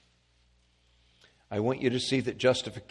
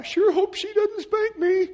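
put-on much higher, louder child's worried thought; little boy voice; 8 dB louder than usual. Boy fearing a spanking from his mother, high child voice. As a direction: think it like a scared small boy.

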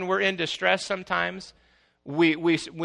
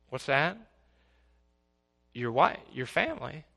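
usual speaking voice

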